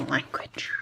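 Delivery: whisper. Real, not acted. Whispered self-scold.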